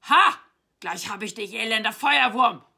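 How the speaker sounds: very loud triumphant battle cry; full-force acted roar; roaring knight. A narrator's reading. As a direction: bellow a threat as a storybook knight. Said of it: "brüllte der weiße Ritter".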